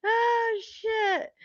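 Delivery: very high, soft, spent from laughing; helpless laughter. Real, not acted. Streamer overheard swearing on air, helpless laughter. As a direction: squeak it out at the tail of a real laugh. Cracking up at having been overheard.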